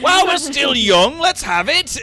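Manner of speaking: high, loud impatient call; impatient chivvying. Acted. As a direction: holler at a dawdler to hurry. Barden hurries the stunned brewer.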